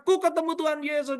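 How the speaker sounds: high, quick eager announcement; excited. Acted. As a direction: blurt out the news in acted excitement. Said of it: "dia semangat". (seruan cepat penuh semangat)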